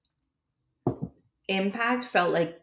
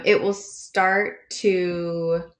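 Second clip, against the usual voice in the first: slow reading; 4 dB louder, much slower than usual. Shown as a demonstration. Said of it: "the author changes his voice a little bit".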